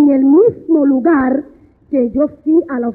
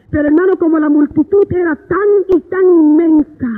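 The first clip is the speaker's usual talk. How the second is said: ordinary delivery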